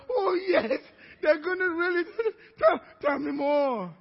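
high, loud gushing agreement; hammed-up rapt listener; mock eagerness. Worrier in bed lapping up Satan's ministry, mock eager.

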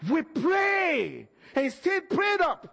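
slow, high preaching shout. Real, not acted. Preacher urging the congregation, slow high shout. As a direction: call it out slowly to the church.

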